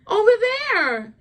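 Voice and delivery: high, drawn-out mock plea; insistent pleading. Woman insisting where she wants to sit, high mock pleading. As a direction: stretch it out like a clingy woman insisting. Acting her insisting on the seat.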